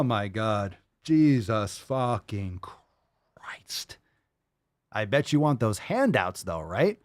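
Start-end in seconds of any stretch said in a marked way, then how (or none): 1.0-4.0 whispered, slow disbelief; exasperated whisper. Real, not acted. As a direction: breathe it out in disbelief. Exasperated under breath.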